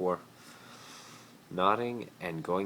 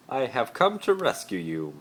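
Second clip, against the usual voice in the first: higher, loud heroic declaration; gallant Malfoy; 7 semitones higher, 7 dB louder than usual. Acted. Malfoy announcing a rescue to Harry, gallant and loud. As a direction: announce it like a hero. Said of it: "Malfoy said".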